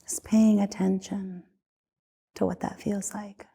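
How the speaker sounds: soft whisper, fading at the end. Acted. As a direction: breathe the words out gently. Meditation guide drops to whisper.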